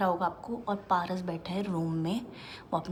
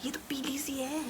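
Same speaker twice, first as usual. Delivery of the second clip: breathy hushed remark; hushed worry that the tea looks yellow; whisper; 6 semitones higher, 6 dB quieter, slower than usual. Real, not acted. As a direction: whisper it under your breath. Whispering about fake tea.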